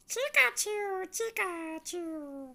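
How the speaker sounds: high squeaky Pikachu impression, stretched slowly. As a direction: squeak it out like the cartoon creature. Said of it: "she can do Pikachu really well".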